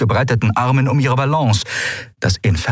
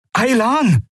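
hoher Ruf des Namens „Aylan“; „Aylan!“ laut nach ihr rufen; gerufen. Acted. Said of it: "rief Kai". (Kai ruft verzweifelt nach Aylan)

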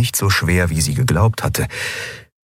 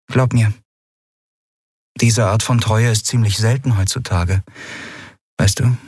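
higher, easygoing character voice; lightly voiced friendly Lien; Lien's voice; 4 semitones higher than usual. A narrator's reading. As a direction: shrug it off lightly, voice lifted. Lien praises the crew.

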